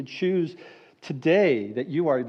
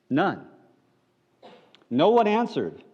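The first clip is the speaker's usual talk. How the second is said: usual speaking voice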